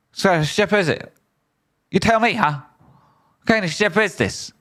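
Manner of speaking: very high mock interrogator voice; high, put-on comic questioning; high-pitched questioning character. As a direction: pipe the questions up in a put-on falsetto. Puts on a high voice while questioning.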